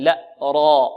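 loud heavy را without rounding. Shown as a demonstration. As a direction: say را loud, lips open. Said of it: "لا نقول را ضم الشفتين".

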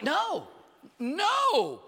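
high, drawn-out cry of no; exclaimed refusal, second one bigger; emphatic refusal. Acted. Acted emphatic refusal to jump.

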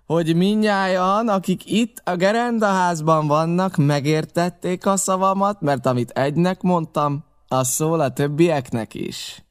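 pirate cook raising his voice to the blockhouse. A narrator's reading. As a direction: lift the voice so everyone inside hears. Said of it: "azzal fölemelte a hangját".

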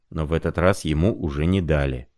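ordinary delivery

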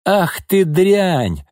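high, slow, drawn-out fury; enraged. A narrator's reading. Mother insulting the young woman, enraged. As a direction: stretch the insult out in rage. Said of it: "рассвирепела Нина".

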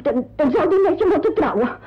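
ordinary delivery

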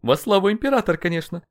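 high, loud mock salute; wry chuckling tone. A narrator's reading. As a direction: toss it in as a sarcastic aside. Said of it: "со смешком добавил Толтенолли".

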